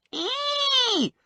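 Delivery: very high, held squeal; shriek. A narrator's reading. Little man reacting to the handcuff, high-pitched shriek. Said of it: "Briggs shrieked".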